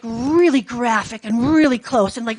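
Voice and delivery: ordinary talk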